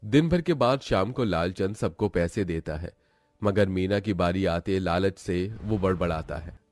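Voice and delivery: ordinary delivery, nothing marked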